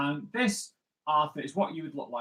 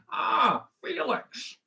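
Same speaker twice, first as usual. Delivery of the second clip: high, swooping celebrity impression; hammed-up Bond actor greeting colleagues; Sean Connery; 7 semitones higher, slower than usual. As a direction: put on a playful, pitched-up film-star voice. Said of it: "That's my impression of Sean Connery".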